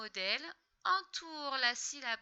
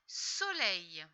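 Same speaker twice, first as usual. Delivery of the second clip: soleil drawn out, slow and falling; slow word; 4 semitones lower, 4 dB louder than usual. Shown as a demonstration. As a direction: stretch soleil so the child hears each sound. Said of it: "word given slowly".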